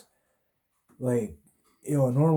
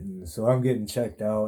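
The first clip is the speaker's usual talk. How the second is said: usual speaking voice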